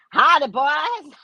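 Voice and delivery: slow, drawn-out Southern hillbilly greeting; hillbilly accent. Retold or imitated. Comic to the chat, slow Southern hillbilly howdy. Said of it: "Trailer Park hillbillies".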